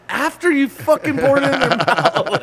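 high, loud outraged retort; shouting down his excuse; exasperated yell. Retold or imitated. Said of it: "I'm like".

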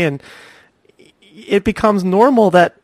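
usual speaking voice

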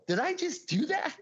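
high-pitched incredulous question; amazed disbelief. Retold or imitated. Wondering if his life's job is done, high amazed disbelief.

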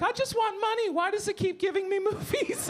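put-on very high, wailing old-lady voice. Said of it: "she was like".

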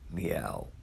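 low flat meow; cat meow. Retold or imitated. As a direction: mimic the cat lazily. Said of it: "the cat said".

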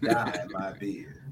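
low, soft, slow mock denial; Bill Clinton. Host jokingly denying beard dye, light Bill Clinton impression. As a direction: draw it out like a solemn politician, lightly imitated. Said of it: "in my Bill Clinton voice".

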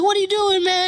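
high-pitched retold protest; alarmed bystanders. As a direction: imitate people trying to stop a fight. Acts crowd restraining brother.

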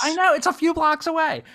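high, loud, exasperated cry. Real, not acted. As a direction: wail it in comic frustration. Lamenting a missed show nearby.

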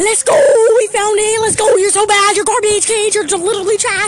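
loud, high gloating taunts; triumphant teasing shouts; excited trash talk. Real, not acted. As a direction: crow over the win at her friend. Found the spray, taunting friend.

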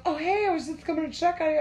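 put-on high-pitched, quick girlfriend voice; imitated girlfriend. Girlfriend coming to check on him, imitated. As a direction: mimic her casual check-in in a high voice. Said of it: "and she goes".

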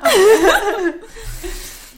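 bursting high-pitched laugh; loud high laugh. Real, not acted. Host cracking up at the toast, loud high laugh. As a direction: let out a loud shrieking laugh.

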